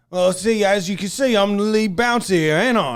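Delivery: high, loud self-important Cockney; Cockney bouncer. Retold or imitated. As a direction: lay down the law as a Cockney lead bouncer. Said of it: "Cockney British".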